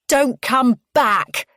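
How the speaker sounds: loud, high, slow parting shout; angry shout, each word separate; yell. A narrator's reading. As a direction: yell it after someone leaving. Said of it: "I yell at her back".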